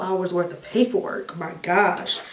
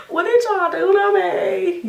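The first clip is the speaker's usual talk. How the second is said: high, slow, dazed cry of alarm; panicked waking; 12 semitones higher, slower than usual. Retold or imitated. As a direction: sound lost and scared coming out of anesthesia. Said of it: "I'm like".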